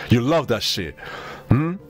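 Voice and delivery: ordinary talk, nothing marked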